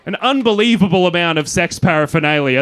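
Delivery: ordinary talk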